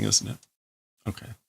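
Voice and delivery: ordinary talk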